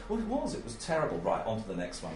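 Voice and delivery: flat, hurried, wanting to move on; understated fed-up interviewer voice; impatient, dismissive. Retold or imitated. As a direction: brush the answer aside, mildly bored. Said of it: "the tone of my voice very much said that I really had enough".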